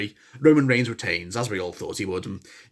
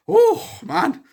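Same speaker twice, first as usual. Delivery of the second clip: delighted exclaim. Real, not acted. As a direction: savor the moment. Delighted about crowd chant.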